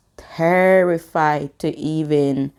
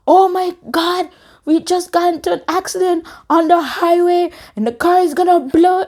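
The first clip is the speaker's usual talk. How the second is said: high, loud panicked phone call; frantic, high-pitched plea for help; 13 semitones higher, 3 dB louder than usual. Retold or imitated. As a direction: cry out in alarm to a friend. Panicked phone call after crash.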